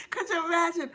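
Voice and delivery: giggling high; laughing. Real, not acted. Host laughing at her own cheer idea. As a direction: laugh through it.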